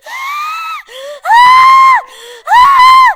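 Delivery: very high, loud fake wails; hammed-up toddler crying shrieks; mock bawling. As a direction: wail like a crying two-year-old. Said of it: "this man starts crying like he was Caillou".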